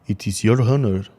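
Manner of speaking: lightly put-on voice of a smug hero; proud declaration in a slightly altered voice; arrogant Sunfire. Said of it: "he's saying stuff like".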